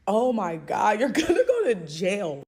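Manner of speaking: loud with a laugh breaking through; loud laughing outburst; outraged yell. Retold or imitated. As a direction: shout it half-laughing in disbelief.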